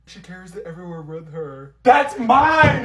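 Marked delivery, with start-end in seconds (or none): 1.8-2.8 high, loud yell; furious shout to protect the spray; angry shout. Acted. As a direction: scream at her to keep away. Girl protects her spray can.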